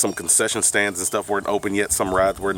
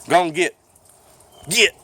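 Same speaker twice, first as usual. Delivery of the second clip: loud, higher shout; shooing a dog; 9 semitones higher, 5 dB louder than usual. Real, not acted. Man to the big brown dog he dislikes, real shooing shout. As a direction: chase it off with short, firm calls.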